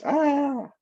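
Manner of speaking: high-pitched mock baby wail; imitated baby yell. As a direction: let out a drawn-out "aah" like an upset baby. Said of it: "baby yells".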